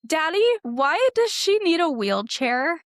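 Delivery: retold loud, high little-girl question; loud child's question. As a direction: blurt it out like a curious kid. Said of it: "she just very loudly was like".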